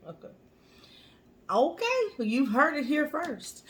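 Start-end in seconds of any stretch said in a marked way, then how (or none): 1.5-3.6 upbeat, high news-anchor read; newscaster voice. Pretend. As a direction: announce it like a TV anchor. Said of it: "I got my newscaster voice on".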